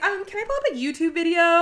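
high, loud, giggling ask; playful mock helplessness; laughing mock question. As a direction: pretend clueless pilot, laughing. Joking she'd need a tutorial.

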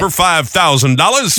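high, slow, drawn-out price call; auctioneer. Acted. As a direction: close the sale like an auctioneer. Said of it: "The auctioneer".

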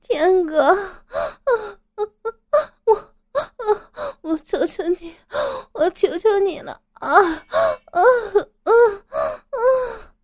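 high, gasping, sobbed pleading; sobbing moans. Acted. Woman pleads with her lover, sobbing moans. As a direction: beg in high, broken sobs. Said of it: "发出抽噎的声音".

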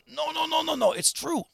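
high, insistent half-whisper; squeaky no-no-no sinking into whispered insistence; urgent pleading. Retold or imitated. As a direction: imitate desperate staff swearing it's true. Staff plead that it's true.

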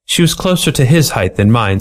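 ordinary delivery, nothing marked

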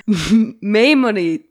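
Maimoni- garbled as MAY-muh-nee, cut off by giggles; botched pronunciation. Real, not acted. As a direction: attempt Maimoni- slowly and give up laughing. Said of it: "can you say it again?".

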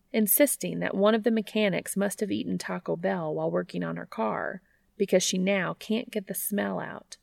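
usual speaking voice

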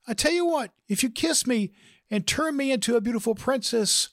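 high, put-on lady's voice, insistent; high-pitched female frog pressing her offer; feminine frog speaking up. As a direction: pitch it way up and bargain eagerly. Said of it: "speaks up again in a little louder voice".

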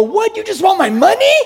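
high, loud mock indignation; mock outrage. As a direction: play the shocked cheapskate customer. Acting the outraged customer.

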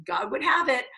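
ordinary talk

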